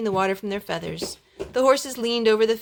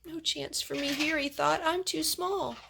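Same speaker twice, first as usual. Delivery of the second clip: sad; 7 semitones higher, 5 dB quieter than usual. A narrator's reading. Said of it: "walked off sadly".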